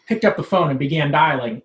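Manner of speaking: usual speaking voice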